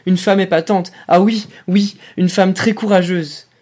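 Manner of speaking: the broken lieutenant hurls the captain's compliment back, bitter shout; bitter frantic outburst. A narrator's reading. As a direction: throw the words back at him in a frenzy. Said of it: "Les lèvres tremblantes jetaient des mots en déroute".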